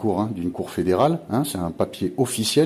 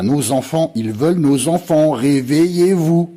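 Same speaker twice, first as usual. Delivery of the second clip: louder and higher, "réveillez-vous" slow and drawn out; angry alarm; 6 semitones higher, 6 dB louder than usual. Real, not acted. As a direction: shout the warning in anger. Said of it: "comme moi un peu en ce moment". (colère, crie « réveillez-vous » lentement)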